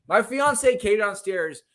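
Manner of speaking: normal talking voice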